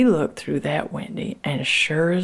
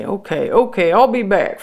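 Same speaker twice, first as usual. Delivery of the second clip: higher, louder hurried reassurance; flustered panic; 5 semitones higher, 5 dB louder than usual. Acted. Boss Man panicking at Frankie's coughing fit, flustered and higher. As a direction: blurt it out, rattled and backing off. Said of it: "boss man kinda start to panic".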